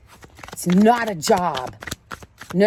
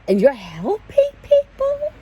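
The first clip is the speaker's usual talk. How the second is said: high, cracking with a cry; voice breaking; 14 semitones higher, 3 dB louder, slower than usual. Real, not acted. Praising those who serve, voice cracks tearfully. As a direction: let the feeling break through.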